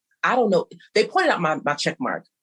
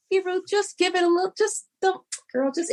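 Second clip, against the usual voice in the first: pitched way up, coaxing friend voice; high soothing advice; 12 semitones higher than usual. Retold or imitated. Talking a friend Ruth down, high coaxing voice. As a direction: coax a friend to let it go, high up.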